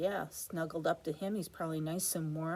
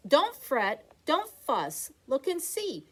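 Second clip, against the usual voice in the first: higher, lightly squeaky little mouse; 7 semitones higher, 4 dB louder, slower than usual. Acted. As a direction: soothe the bear like a tiny mouse. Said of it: "Mouse squeaks".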